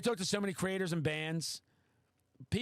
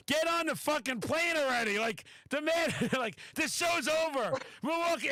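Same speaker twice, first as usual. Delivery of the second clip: higher, louder mock exasperation, half laughing; impatient friend; 9 semitones higher, 3 dB louder than usual. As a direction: hurry him along like you're fed up but amused. Guy hurrying him onto plane.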